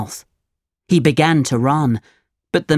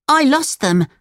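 put-on very high, guilty little boy; lying puppet voice; 11 semitones higher than usual. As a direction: squeak out the fib. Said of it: "he said".

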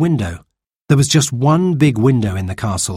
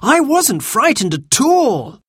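put-on high, stretched-out child's boast; high, emphatic childish claim; eager cry; 13 semitones higher, slower than usual. A narrator's reading. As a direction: insist eagerly as a little girl. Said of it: "cried Jane".

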